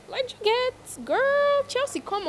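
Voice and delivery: outraged disbelief at a friend; incredulous. Real, not acted. Shocked reaction to the toxic claim.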